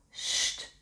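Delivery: soft, voiceless shushing; whispered hush. A narrator's reading. Aunt hushes the friends about a secret, whispered shush. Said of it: "fluistert tante Berenboom".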